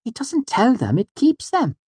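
very high, serious little voice; Auri. A narrator's reading. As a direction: correct him earnestly, stressing the contrast. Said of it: "shook her head seriously".

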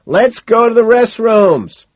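loud, slow, high shout. A narrator's reading. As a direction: shout back over the music. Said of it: "shout back".

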